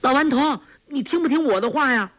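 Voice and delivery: put-on girl calling out. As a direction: tease the old man from across the fog. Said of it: "只听黄蓉叫道".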